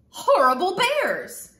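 loud, high, drawn-out grumble-shout; angry girl. A narrator's reading. Girl too upset to read, loud angry outburst. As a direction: shout it crossly, stretched out.

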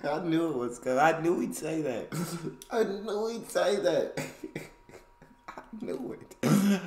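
high-pitched, cracking up between words; laughing. Real, not acted. Reacting to a joke he saw coming, helpless laughing. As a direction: squeal it through real laughter.

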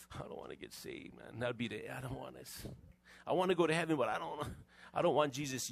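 a nervous churchgoer thinking quietly; hushed reluctant thought. Retold or imitated. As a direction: whisper the fear to yourself. Voicing a fearful churchgoer.

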